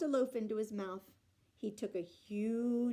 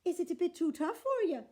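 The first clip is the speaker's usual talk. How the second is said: sweet teasing; 7 semitones higher, 5 dB louder than usual. A narrator's reading. Unag teasing the giant.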